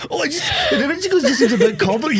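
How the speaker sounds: high whiny parroting; mocking mimicry. Host parrots co-host's convoluted complaint, high mocking voice.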